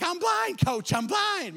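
high mock panic; panicked cry. Boy telling the coach he is blind, high mock panic. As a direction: wail it like a dazed kid. Panicked kid after hit.

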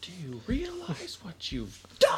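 breathy dramatic whisper. Retold or imitated. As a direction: hush it like a movie line. Movie quote, second take.

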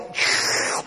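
airy voiceless mock horse laugh; hammed-up drunk laughter; breathy horse laugh. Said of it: "the horse is like".

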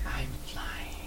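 unvoiced breathy whisper. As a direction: whisper it right into the ear. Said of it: "just kinda said".

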